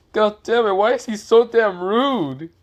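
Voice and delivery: high, loud hammed-up complaint; exasperated outburst. The Hokage complaining while everyone laughs at him, exasperated. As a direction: wail in exasperation, drawing out the end.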